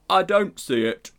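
high, loud doubting bear. A narrator's reading. Bear doubting the uncle is an animal.

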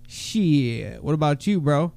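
ordinary talk, nothing marked

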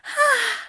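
high gasp of delight; shocked gasp. Acted. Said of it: "Aziraphale gasped".

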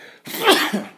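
real sneeze, caught short; sudden sneeze mid-sentence. Real, not acted. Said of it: "I'm gonna sneeze".

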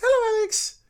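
very high squeaky little voice; tiny voice. Retold or imitated. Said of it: "In a tiny voice".

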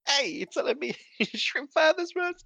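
high, drawn-out character impression broken by laughter; attempted Itmon voice. Co-host attempting the Itmon voice for a meme line, badly put-on accent. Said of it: "trying to do an accent again".

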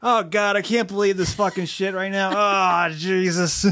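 high, exasperated mock meltdown; mock exasperated rant, high pitched, laughing at the end; stressed-out rant impression. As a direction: ham up a frazzled boss losing it, pitched high. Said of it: "And you're like".

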